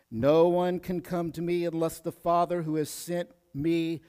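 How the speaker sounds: ordinary talk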